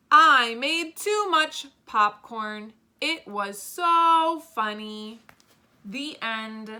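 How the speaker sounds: slow, loud, deliberate read-aloud; reading back. Shown as a demonstration. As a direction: read the finished sentences out slowly and clearly. Reading finished story back.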